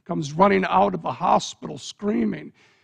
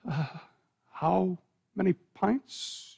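softer, slow, hesitant question; hesitant dread; 7 dB quieter, slower than usual. Acted. Parent asks the doctor about the blood, slow and hushed. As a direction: afraid of the answer, ask it slowly.

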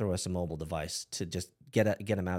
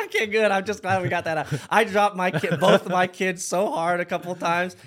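high, loud laughing confession; 11 semitones higher, 11 dB louder than usual. Real, not acted. Host admits dropping kids, laughing loudly.

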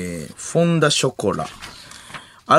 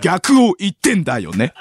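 higher, theatrical twist reveal; 関明夫 impression's punchline voice; 5 semitones higher, 4 dB louder than usual. Repeating the twist for the partner, punchline voice. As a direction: punch the first word, swoop through to the tag. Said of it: "音声変わるけど".

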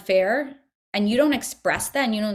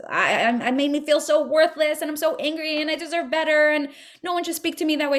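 higher, rushed venting of hurt anger; mock venting; 6 semitones higher than usual. Shown as a demonstration. Teacher demonstrating how to steam out anger alone, mock venting. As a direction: let the hurt spill out fast, half acted. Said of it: "Talk to yourself and steam that out".

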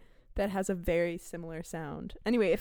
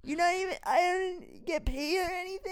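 whiny, raised bro voice; a whiny, pitched-up guest complaining; annoying character voice; 9 semitones higher than usual. Acted. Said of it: "he got really annoying really fast".

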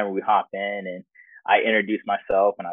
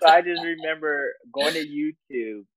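laughing; 8 semitones higher than usual. Real, not acted. Laughing after Get Out joke.

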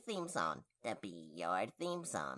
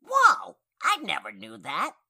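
surprised; 3 semitones higher, 11 dB louder than usual. Acted. Surprised by antler news.

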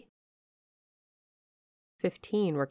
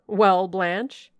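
impatient; 3 semitones higher, 11 dB louder than usual. Acted. Lord Ingram demands news.

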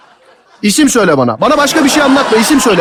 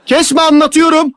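higher-pitched, scolding demand; raised voice; 5 semitones higher, much slower than usual. Real, not acted. Caller tells the woman not to interrupt, raised voice. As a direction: cut off the interruption sharply. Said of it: "Tamam, bağırma".

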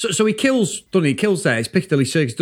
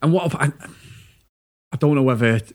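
ordinary talk, nothing marked

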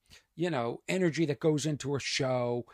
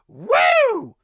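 shrieked high woo, arms up; mock party woo; 10 dB louder than usual. Comic imitating a heckler's piercing woo. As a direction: imitate the drunk lawyer's woo. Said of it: "somebody going".

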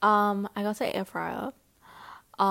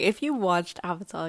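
ordinary talk, nothing marked

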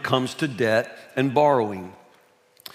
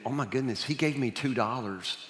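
hushed dread; panicked thought; 2 semitones lower, 9 dB quieter than usual. Retold or imitated. Boy realizing he owes money, hushed dread. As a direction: murmur it in sudden dread. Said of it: "I'm thinking".

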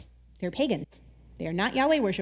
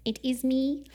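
much softer, mild guilty hush; guilty confession; 5 dB quieter than usual. Retold or imitated. Jonah confessing to the sailors, soft mild guilt. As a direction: quietly own up as Jonah. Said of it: "he finally is like".

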